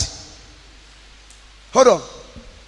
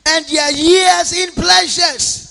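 preaching; 5 semitones higher, 5 dB louder than usual. Acted. Preaching to the congregation.

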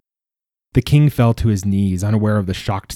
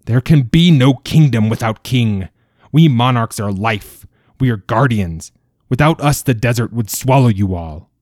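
loud and higher, a roaring royal decree; furious proclamation; 3 semitones higher, 6 dB louder than usual. A narrator's reading. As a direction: thunder it like a wrathful monarch. King rages at his people.

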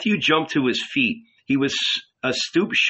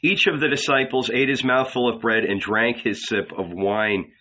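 ordinary delivery

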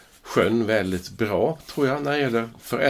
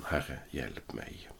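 much softer, slow "Herre, hjälp mig", almost breathed out; retelling Peter sinking, a hushed understated cry; hushed plea; 6 semitones lower, 13 dB quieter, much slower than usual. Retold or imitated. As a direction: let "Herre, hjälp mig" sink away, quiet and slow.

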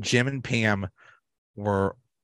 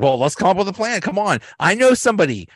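high, louder, eager pitch; imitating Michael; 9 semitones higher, 4 dB louder, faster than usual. Michael promising the angry board a plan, high and eager. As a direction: sell it like a boss with a sudden idea, pitched up. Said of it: "Michael's like".

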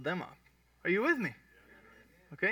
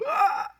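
a high shocked yelp, acted out; short startled cry; mock scream; 6 dB louder than usual. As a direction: squeal like someone hit by a harsh word. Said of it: "when I see you go".

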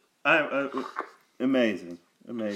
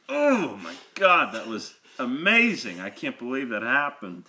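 retold excitement. Telling how he screamed alone in his truck, retold excitement. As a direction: relive the thrill with a mild exultant yell and a laugh. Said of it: "in excitement. That's, like, kinda how it was".

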